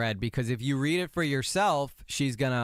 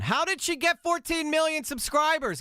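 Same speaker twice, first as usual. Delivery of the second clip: animated exclamation. Shown as a demonstration. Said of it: "incredibly boring and monotone".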